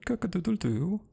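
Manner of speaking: high crowing call; rooster crow. Acted. Rooster character crows.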